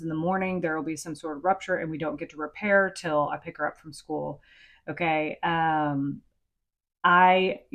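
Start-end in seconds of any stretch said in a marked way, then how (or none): none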